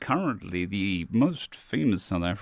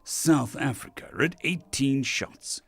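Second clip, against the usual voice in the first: grand, theatrical alien storyteller, higher than usual; declaimed first-person tale in a lofty character voice; proud alien drinking champion; 9 semitones higher, 6 dB louder than usual. Acted. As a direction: boast like a galactic champion telling his tale.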